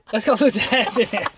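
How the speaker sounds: ordinary talk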